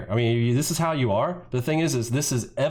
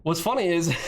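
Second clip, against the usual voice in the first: ordinary delivery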